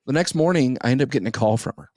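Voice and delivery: ordinary talk, nothing marked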